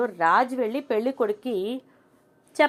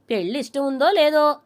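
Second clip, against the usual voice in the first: raised voice, pushed out hard; loud retort; 5 semitones higher, 5 dB louder than usual. Retold or imitated. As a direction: say it loudly, exasperated. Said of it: "గట్టిగా అంటాడు". (గట్టిగా అరుస్తూ)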